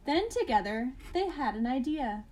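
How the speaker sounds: ordinary delivery, nothing marked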